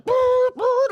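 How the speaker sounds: very high siren honk; imitated alarm blare. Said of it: "every bell and whistle and alarm in me".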